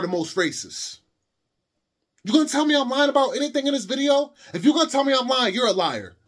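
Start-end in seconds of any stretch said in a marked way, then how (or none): 2.2-6.2 higher, louder, daring anyone to call him a liar; loud challenge. Real, not acted. Ranting at doubting viewers in the comments, loud and defiant. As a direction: throw the challenge straight at the camera.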